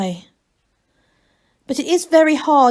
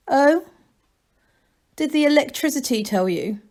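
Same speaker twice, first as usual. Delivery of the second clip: mockingly; 5 semitones higher than usual. A narrator's reading. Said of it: "said the abbot mockingly".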